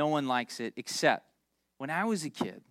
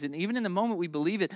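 normal talking voice

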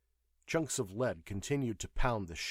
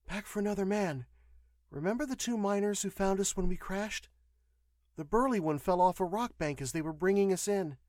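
higher-pitched woman's voice, matter-of-fact; explaining nurse; 9 semitones higher than usual. A narrator's reading. Nurse tells the patient about injured miners, explanatory. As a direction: explain it in a raised female register.

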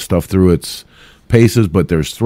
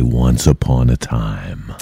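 slow, low epic trailer voice; movie trailer voice; 7 semitones lower, 4 dB quieter, slower than usual. Pretend. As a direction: announce it like a film trailer. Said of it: "the epic movie trailer voice".

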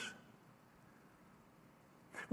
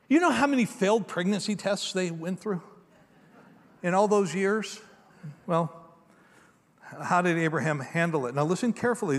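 ordinary delivery

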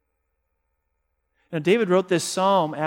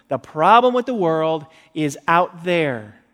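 slow emphatic declaration; 8 dB louder than usual. Acted. Preacher's climax line.

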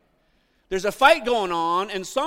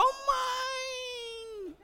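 very high, stretched-out mock wail; high, soft childish howl; childish "mine" cry; 7 dB quieter than usual. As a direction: whine it like a possessive kid. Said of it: "and I go".

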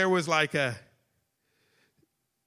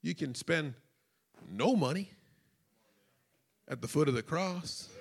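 soft preaching; 5 semitones lower, 10 dB quieter than usual. Acted.

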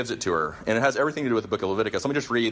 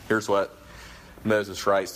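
ordinary delivery

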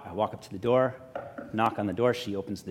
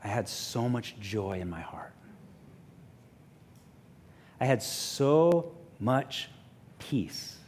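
soft sincere testimony; 5 dB quieter, slower than usual. Real, not acted. Reflective testimony of joy.

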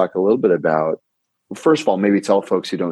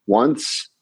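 staccato; much slower than usual. Shown as a demonstration. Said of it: "let's try it right now".

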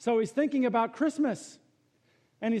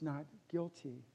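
much softer, slower Not guilty; hushed verdict; 4 semitones lower, 12 dB quieter than usual. Real, not acted. Preacher telling hearers the verdict, hushed. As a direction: say Not guilty softly, almost reverent. Sermon quiet climax.

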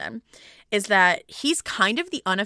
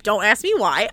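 normal talking voice

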